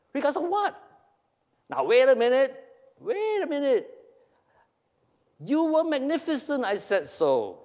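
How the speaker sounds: high, agitated back-and-forth; high-pitched acted squabble; rising protest. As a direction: seize on the word and object. Acted quarrel over backhanded praise.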